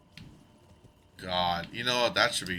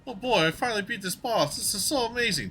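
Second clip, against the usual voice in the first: high, bright fake delight; mock cheerful gamer; 12 semitones higher, faster than usual. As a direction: gush like a pleased player. Acting a player beating a boss.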